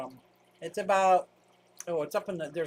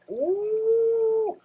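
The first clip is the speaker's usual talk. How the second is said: long, high, loud ooh; playful "ooh"; 6 dB louder than usual. Real, not acted. Joking with viewers about a pinch, playful high ooh. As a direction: let out a drawn-out high ooh.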